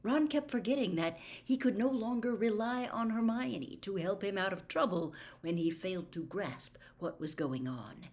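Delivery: plain reading voice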